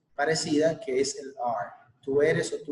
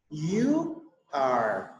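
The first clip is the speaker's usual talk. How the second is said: model for repetition. Shown as a demonstration. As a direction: clear model phrase with a pause after it for the class to repeat. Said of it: "Listen and repeat". (modelo claro para repetir)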